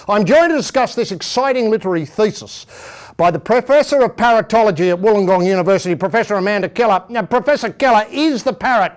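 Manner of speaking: loud, hammed-up talk-show host; pompous host voice. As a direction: announce it like a self-important broadcaster.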